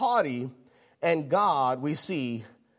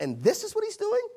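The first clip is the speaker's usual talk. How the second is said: high-pitched question, rising in disbelief; incredulous God; 13 semitones higher than usual. Acted. God looking down at the preacher's life, incredulous. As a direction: let the voice leap up in disbelief. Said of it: "God looks down at my life and says".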